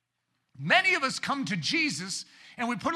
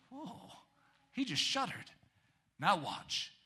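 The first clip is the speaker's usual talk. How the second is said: a mock hushed aside, much softer; awed hush; 7 dB quieter, slower than usual. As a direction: lean in and murmur in wonder. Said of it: "look up and go".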